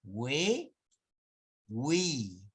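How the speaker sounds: "We, wi" with U voiced as W; drawn-out we and wi, glide clearly rounded; U sounded. Shown as a demonstration. Teacher demonstrating the dieresis to class. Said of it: "la diéresis".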